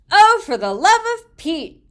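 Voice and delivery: loud, high exasperated mother. A narrator's reading. Mother reacting to the escaped mouse, loud exasperated cry. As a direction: throw up your hands as mom. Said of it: "said my mother".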